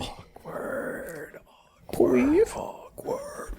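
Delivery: rising whispered chant. Real, not acted.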